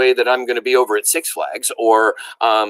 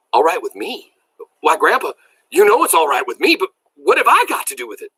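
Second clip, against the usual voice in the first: dumbfounded; 7 semitones higher, 5 dB louder than usual. A narrator's reading. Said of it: "I was dumbfounded".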